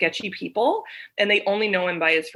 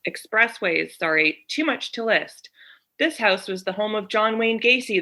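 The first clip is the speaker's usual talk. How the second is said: normal talking voice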